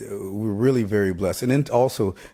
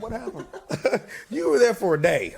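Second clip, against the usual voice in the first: much higher, laughing mock disbelief from the folks back home; mock baffled friends; 14 semitones higher than usual. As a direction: play the stunned friends back home, giggling. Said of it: "They'll be like".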